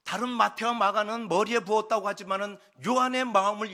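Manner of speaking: high, loud, driving sermon voice; full-force preaching. Acted. Preacher calling the congregation to absolute obedience, impassioned. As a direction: drive the call home with mounting force.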